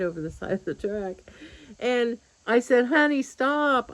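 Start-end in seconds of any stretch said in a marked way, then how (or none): 2.9-3.9 drawn-out, higher plea to stop; imploring, stretched and raised; pleading. Retold or imitated. Buyer begs eager helper to stop lifting, pleading.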